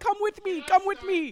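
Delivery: high-pitched mock Arabic accent. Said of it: "weirdly yelling in an Arabic accent".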